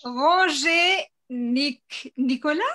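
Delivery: "Ranger Nicolas" slowly as ron-ZHAY nee-ko-LA; slow French "Ranger", broken off and rising on "Nicolas"; French pronunciation. Shown as a demonstration. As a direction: spell out "Ranger Nicolas" by ear, French style. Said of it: "How would you say Ranger Nick in French".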